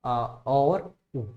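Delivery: अ and उ drawn out very slowly, one by one; walk the class through the sounds; slow sound breakdown. Shown as a demonstration. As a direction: split the glide into its two vowels slowly. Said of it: "इसको आप बोलते हो".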